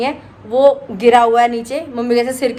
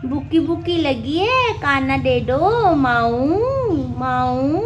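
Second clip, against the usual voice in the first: cooing pet talk; 2 semitones higher, much slower than usual. Real, not acted. Cooing to the cat.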